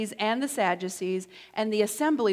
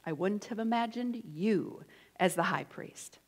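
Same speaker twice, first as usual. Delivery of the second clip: softer; 2 semitones lower, 7 dB quieter than usual. Shown as a demonstration. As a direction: drop it down, quiet and sly. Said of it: "Where is the emphasis in that sentence?".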